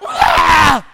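loud, high mock tantrum shriek; imitated toddler scream. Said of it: "She went".

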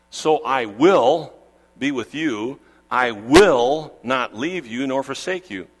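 "will" stretched out loud and slow; slow, weighty stress on each "will"; emphatic promise. Acted. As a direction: lean long and hard on "will", like a pledge. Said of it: "It's emphatic".

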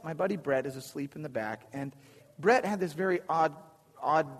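normal talking voice

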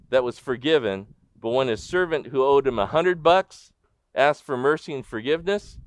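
ordinary delivery

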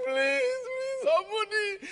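high, tearful begging; crying plea. Retold or imitated. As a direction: plead through mock sobs, pitch way up. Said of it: "he's crying".

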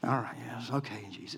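soft, sheepish reply; meek disciples. Retold or imitated. Disciples meekly obey Jesus, soft and sheepish. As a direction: mumble it like scolded kids. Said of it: "disciples answering meekly".

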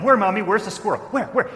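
put-on high, loud eager children asking; children asking their mother, high and excited; excited kids. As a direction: voice the kids, all curiosity. Kids voiced in a story.

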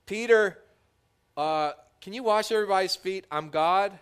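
lordly "I'm God", hammed-up; mock pulling rank. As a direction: pretend Jesus throwing his title around. Hypothetical Jesus pulling rank.

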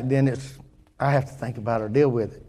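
ordinary delivery

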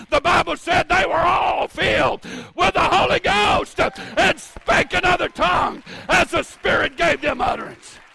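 loud, high fiery preaching; preaching at full force. Acted. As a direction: shout it to the congregation. Fiery climax on Acts 2:38.

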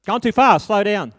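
loud, high barked orders; imitating a grumpy old trainer shouting; trainer yelling. Trainer yelling at learner driver.

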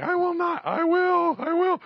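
high, loud back-and-forth screams; frantic screaming. Retold or imitated. Preacher re-enacting his schizo makeover on the floor, screaming. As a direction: scream both answers as if arguing with yourself. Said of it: "getting a schizo makeover".

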